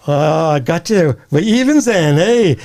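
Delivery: slow, then high gloating rabbi; a Yiddish rabbi's triumphant eh; Yiddish accent. Acted.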